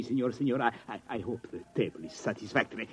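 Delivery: usual speaking voice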